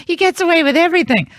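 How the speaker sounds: mock-whiny daughter, high and nasal-free; hammed-up whine. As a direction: ham up a sister's jealous complaint. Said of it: "She's like".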